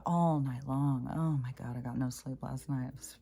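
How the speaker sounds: normal talking voice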